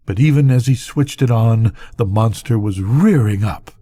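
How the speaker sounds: ordinary delivery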